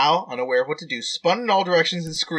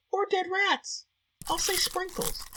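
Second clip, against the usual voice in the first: squeaky voice; 14 semitones higher, 7 dB quieter, slower than usual. Real, not acted. Player picks cookie topping.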